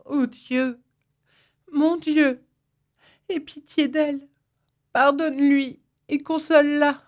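high, thin, imploring voice; crying out in prayer. A narrator's reading. Suzanne on her knees asking God to pity the mother, imploring prayer. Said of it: "en s'écriant tout haut".

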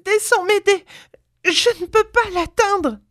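very high, frantic plea; high-pitched distressed old woman; panting, anguished cry. A narrator's reading. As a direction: call desperately for help, out of breath. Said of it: "la voix haletante et angoissée de sa compagne".

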